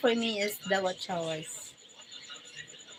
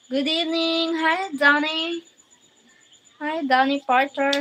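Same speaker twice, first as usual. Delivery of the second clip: higher, louder welcome; a bright, loud hello to a regular; excited greeting; 6 semitones higher, 7 dB louder than usual. Real, not acted. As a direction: greet Donnie with delight.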